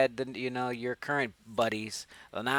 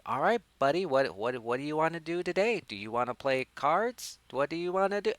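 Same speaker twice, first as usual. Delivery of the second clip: mock-eager voice pitched up at the starts; eager doll buddy; 5 semitones higher than usual. Chucky asking his buddy what to play today, eagerly. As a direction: play an over-keen toy pal, rising on each question.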